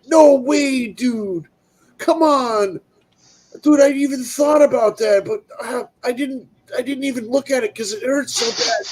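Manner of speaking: high-pitched, whiny imitation of a friend; pained disbelief. Friend learns a piece is stuck inside, high whiny disbelief. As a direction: plead and whine in a high voice.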